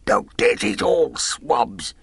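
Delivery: put-on high, slow old sailor cursing; feeble but hearty curse. A narrator's reading. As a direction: spit out contempt for doctors, weak but hearty. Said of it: "cursing the doctor in a feeble voice, but heartily".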